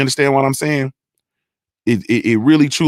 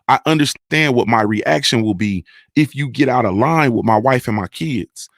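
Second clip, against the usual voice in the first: usual speaking voice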